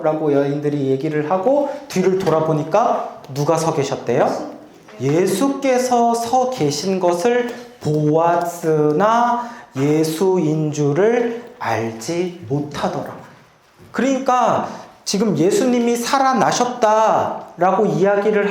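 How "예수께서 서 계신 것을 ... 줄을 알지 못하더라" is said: very slow, every word weighed; solemn drawn-out verse reading. Shown as a demonstration. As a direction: read it slowly like scripture over music. Verse reread slowly over music.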